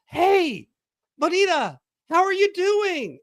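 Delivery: high, bright call across a room; old friend calling across the restaurant, loud and high. Retold or imitated. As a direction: hail an old friend from afar.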